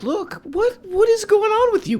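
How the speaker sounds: very high falsetto, mock exasperation; imitated debater. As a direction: parody him in a silly head voice. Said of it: "what he's saying is like".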